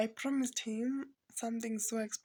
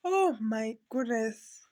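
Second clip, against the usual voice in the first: near tears; 8 dB louder, slower than usual. Real, not acted. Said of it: "I don't wanna cry".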